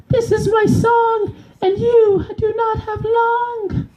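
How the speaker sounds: very high, cartoonish squeaky fish; high squeaky fish, slow and triumphant. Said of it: "versus a fish".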